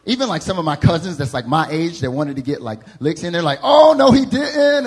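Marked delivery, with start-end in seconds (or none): 3.6-4.8 high, loud and slow, oh no he didn't; scandalized drawl. Retold or imitated. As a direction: stretch it out, shocked and judging. Relatives scandalized.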